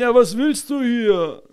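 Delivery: nachgeäffter Typ, höher und langsam gesprochen; genervter Typ. Retold or imitated. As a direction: den ertappten Typen hoch und gedehnt nachmachen. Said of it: "Was sagt der so?". (höher und langsam gesprochen, genervt nachgemacht)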